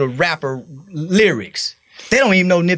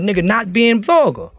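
ordinary talk, nothing marked

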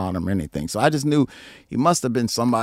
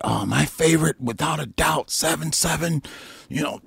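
a lightly raised gushing fan voice; mocking fan; 5 semitones higher than usual. Quoting a Twitter fan's gushing favourite lineup. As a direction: gush like an eager fan. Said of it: "they said".

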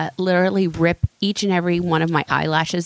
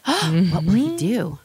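dramatic trailer voice; slower than usual. Pretend. Dramatic cliffhanger after synopsis.

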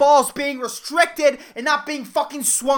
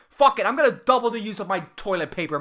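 normal talking voice

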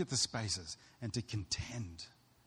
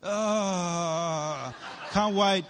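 sarcastic groan; 6 semitones higher, 5 dB louder, much slower than usual. Retold or imitated. Bored at prayer meeting.

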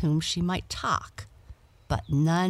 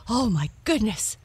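a frightened cat's high cry, a bit louder; startled cry; 6 semitones higher, 4 dB louder than usual. A narrator's reading. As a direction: gasp it out in alarm at the dog. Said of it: "cried Blackie".

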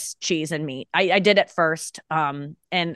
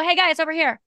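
calling friends over; 8 semitones higher than usual. Retold or imitated. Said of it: "I'll just go".

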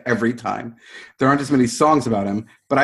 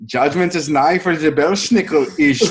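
mock German accent, louder and higher; 4 semitones higher, 5 dB louder than usual. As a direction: proclaim it in a hammed-up German accent. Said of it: "in a German accent".

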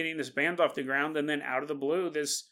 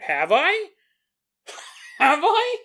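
high, loud sudden worry; startled rising self-doubt; alarmed doubt; 12 semitones higher, 7 dB louder, slower than usual. Real, not acted. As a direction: catch yourself in a panicked question. Doubting her own memory.

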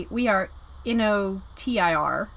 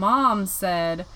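normal talking voice

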